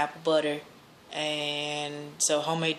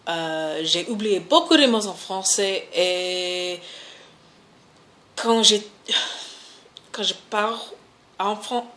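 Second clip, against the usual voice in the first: halting French with an American accent; speaking French. Shown as a demonstration. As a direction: stumble through rusty French, searching for words. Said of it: "as far as speaking another language".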